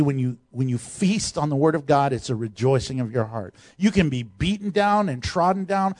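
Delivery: ordinary delivery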